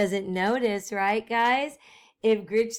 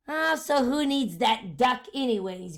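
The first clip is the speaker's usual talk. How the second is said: ordinary delivery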